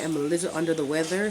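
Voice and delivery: usual speaking voice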